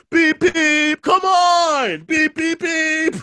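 very high, loud imitated car horn and whine; hammed-up impatient honking and calling out; mock honking driver. Driver honking at a family identifying the body, mock-impatient.

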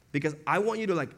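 ordinary talk, nothing marked

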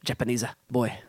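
hammy Italian accent with added vowels; mock Italian, a little closer this time; improved Italian accent. Shown as a demonstration. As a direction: softly fake an Italian accent, adding a vowel after "Japanese". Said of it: "that's a little better".

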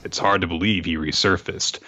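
usual speaking voice

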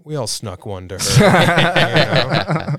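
stretched out through laughter; slow laughing confession. Real, not acted.

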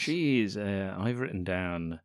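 usual speaking voice

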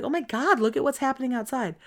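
high, eager wonder; excited gushing. Retold or imitated. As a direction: gush over the view outside. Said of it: "everybody's like".

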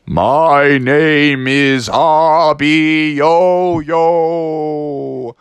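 very slow, drawn-out creepy stranger; monster voice. Acted. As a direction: stretch every word like a looming giant. Tall sinister monster introduces himself.

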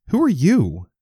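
high, loud confused question. A narrator's reading. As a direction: ask like a stranger. Woke up as someone else.

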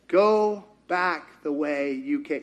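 low, slow, unmoved command; the Lord's command. Acted. God answering Elijah's tantrum, slow and unmoved. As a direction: repeat it calmly and deliberately. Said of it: "and the Lord said".

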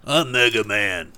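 slow raspy smoker impression; lightly voiced gravel-throated smoker. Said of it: "He sounded like a smoker".

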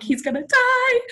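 high, panicked cry; panicked quote. Retold or imitated. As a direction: blurt it out in high alarm. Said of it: "And we're like".

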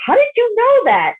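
high astonished disbelief; amazed question. Retold or imitated.

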